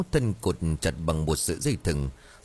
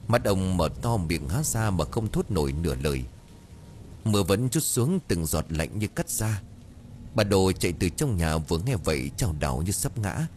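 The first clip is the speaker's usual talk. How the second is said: ordinary delivery, nothing marked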